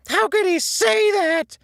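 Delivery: very high, loud and slow cry; anguished wail, drawn out; screamed out. A narrator's reading. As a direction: scream it into the dark. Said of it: "SpongeBob screamed out".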